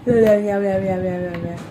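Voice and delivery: low, repeated meows sliding downward; imitated meowing. Showing how the little one goes, low repeated meowing. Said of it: "पोरगं कसं करतं ते?".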